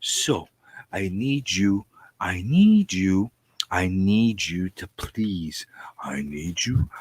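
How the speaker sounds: normal talking voice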